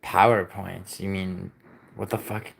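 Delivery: ordinary talk, nothing marked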